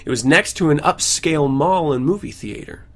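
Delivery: normal talking voice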